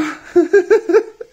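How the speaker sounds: high, loud startled laugh; squealing laugh; burst of laughter. Real, not acted. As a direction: laugh out at the hard card. Laughs at hard date card.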